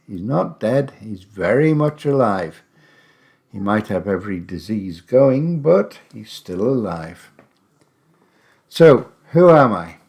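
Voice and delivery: usual speaking voice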